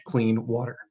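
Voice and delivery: ordinary delivery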